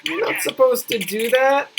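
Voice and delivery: laughing. Real, not acted. Laughing at crash.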